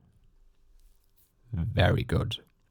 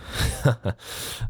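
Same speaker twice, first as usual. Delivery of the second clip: breathy mocking laugh; 4 semitones higher than usual. Villain mocking captive's doubt.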